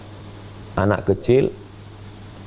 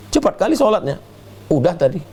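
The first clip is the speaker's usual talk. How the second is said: put-on high childish excuses, raised pitch; mock lying child; 12 semitones higher than usual. Preacher imitates a child claiming prayers done, high-pitched. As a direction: squeak out a kid's fib about praying.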